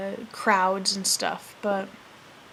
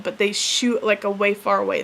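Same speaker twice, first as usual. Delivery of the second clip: usual speaking voice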